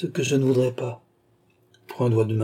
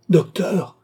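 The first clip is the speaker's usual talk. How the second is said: desperate cry; 6 semitones higher, 5 dB louder than usual. A narrator's reading. Philippe cries out to the leaving doctor in despair. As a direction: cry out high and loud. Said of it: "s'écria Philippe au désespoir".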